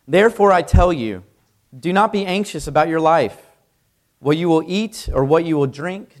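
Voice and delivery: solemn Bible reading with force; scripture reading. Acted. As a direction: proclaim the passage. Reads scripture aloud.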